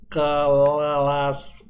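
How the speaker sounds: CLASS drawn out into broken, wavering syllables; scanning speech. Shown as a demonstration. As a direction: pull "class" apart slowly, syllable by syllable. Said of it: "so I will talk like this".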